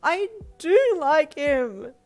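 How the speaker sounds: very loud, high, drawn-out protest; tearful yell. A narrator's reading. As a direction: yell it on the edge of tears. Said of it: "Kari yelled as she started tearing up".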